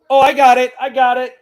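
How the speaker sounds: loud, high burst of triumph; triumphant cry. Real, not acted. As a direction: shout it out, finally got it open. Triumph after struggling with bottle.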